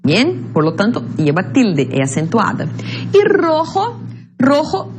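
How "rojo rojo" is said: "rojo" twice, high-pitched with strong first ro; stress on RO. Shown as a demonstration. Teacher modelling a palabra grave for students, stressing ro in "rojo". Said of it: "La sílaba tónica es ro".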